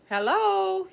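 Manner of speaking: calling out. Acted. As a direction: call across the pond. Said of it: "he called".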